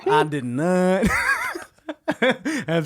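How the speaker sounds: slow, drawn-out botched president impression; Bill Clinton. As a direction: drift from Southern statesman into Borat. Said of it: "Bill Borat over here".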